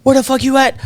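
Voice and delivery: high, calling DJ voice; DJ hype call. Retold or imitated. Club DJ calling Jamie Foxx to the mic, high. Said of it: "D J goes".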